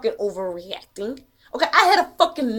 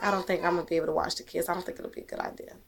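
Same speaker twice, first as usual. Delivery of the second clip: much softer, fast, near-whispered hesitation; hesitant quiet; 7 semitones lower, 12 dB quieter, much faster than usual. Retold or imitated. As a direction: mimic her backing out, hushed and quick. Miss Moore cancels on phone.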